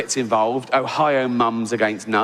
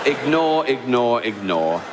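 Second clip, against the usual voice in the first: fading repetition; 2 semitones lower, slower than usual. Real, not acted. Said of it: "repeating to himself, trailing off".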